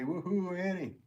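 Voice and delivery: high-pitched woohoo; cheering. Real, not acted. Streamer cheering for Doctor Annie, high and celebratory.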